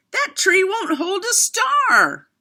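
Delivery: put-on high, loud child's cry; cried out. A narrator's reading. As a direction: call it out high and loud from far off. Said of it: "cried out".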